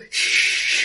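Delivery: long unvoiced shush; hushing "shh". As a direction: shush them, finger to lips. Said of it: "I go".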